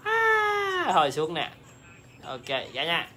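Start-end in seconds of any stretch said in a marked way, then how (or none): 0.0-0.9 surprised shout. Real, not acted. Sudden exclamation.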